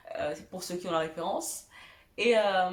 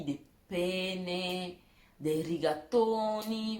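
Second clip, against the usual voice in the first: slow, mock Italian "rigatoni"; Italian accent; much slower than usual. Shown as a demonstration. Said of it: "Vous avez vu mon bel accent italien".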